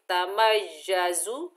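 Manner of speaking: तमय्यजु with the ya held and z whistled; doubled ya, hissing z. Shown as a demonstration. Teacher showing the shadda in तमय्यजु. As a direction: lean on the yy in तमय्यजु. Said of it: "सख्ती और सीटी से अदा करेंगे".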